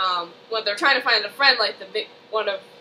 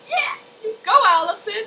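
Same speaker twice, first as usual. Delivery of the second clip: cheering fan; 11 semitones higher than usual. Retold or imitated. Cheering a performance.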